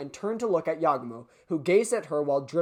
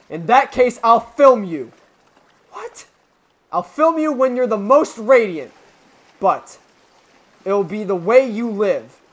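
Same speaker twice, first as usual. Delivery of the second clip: earnest loud plea; 3 semitones higher, 8 dB louder than usual. Acted. Yagumo's plea to Hitoa.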